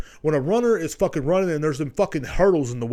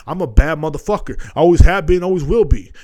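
much louder, proud declaration; loud boast; 2 semitones higher, 8 dB louder than usual. Real, not acted. Bragging to listeners he's tough, loud. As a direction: shout it like a vow.

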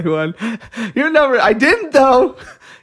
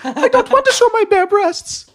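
pleading daughter voice; slower than usual. Retold or imitated. Daughter pleading with her father.

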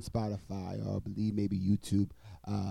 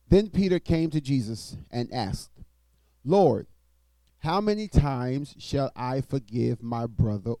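loud, raised preaching read; scripture reading; 2 semitones higher, 8 dB louder than usual. Acted. Preacher reading Matthew aloud to the congregation, loud and proclaiming.

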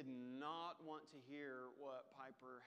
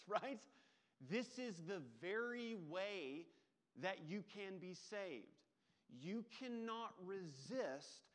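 raised, louder preaching voice; 4 semitones higher, 6 dB louder, slower than usual. Acted. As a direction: drive the point home to the congregation. Preaching illustration.

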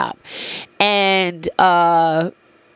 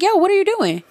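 shocked; 12 semitones higher than usual. Retold or imitated. Retold shocked reply to partner.

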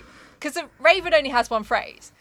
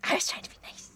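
unvoiced, soft mimicry; whispered echo; 11 dB quieter, faster than usual. Host echoing her co-host's excuse in a whisper. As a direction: whisper it back sheepishly.

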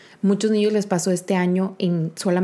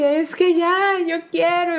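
high, whiny mock sobbing; mock whiny crying; 9 semitones higher, slower than usual. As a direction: whimper it like a worn-out mom in tears. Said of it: "yo estaba llorando". (quejido lloroso exagerado, más agudo)

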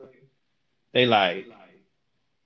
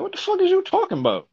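confused; 11 semitones higher than usual. Retold or imitated. Retold confusion on phone.

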